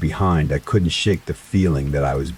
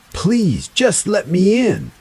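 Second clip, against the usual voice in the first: very high, begging voice; pleading; 4 dB louder, slower than usual. A narrator's reading. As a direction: plead sweetly and eerily. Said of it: "the voice pleaded again".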